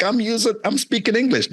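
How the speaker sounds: retold protest, pitched high; mild protest. Younger self protesting to teasing classmates.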